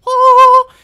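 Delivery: very high, loud mock scream; imitated diving yell. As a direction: let out a high yell as if leaping. Acting out wife's dive into backseat.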